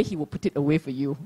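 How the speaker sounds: ordinary delivery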